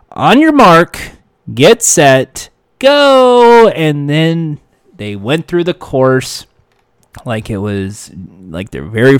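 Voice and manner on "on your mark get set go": building to a loud, high GO; announcer countdown. Retold or imitated. Host finally launching the working take, rising to a loud shout. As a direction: shout the final take's start like a game show host.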